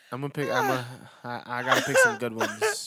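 can't stop laughing while talking. Real, not acted. Cracking up mid-sentence.

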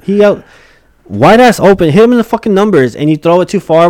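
loud, high, exasperated arguing; heated explanation. Real, not acted. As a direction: hammer the point home. Heated dispute over the play.

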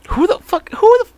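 high, squeaking disbelief; can't believe how stupid; cracked high outrage; incredulous squeak. Real, not acted.

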